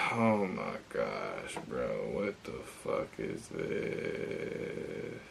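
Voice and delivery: sighed, very slow and drawn out, sinking very low; real exasperation. Real, not acted. Man reacting to a broken delivery, exasperated. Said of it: "I'm kinda pissed".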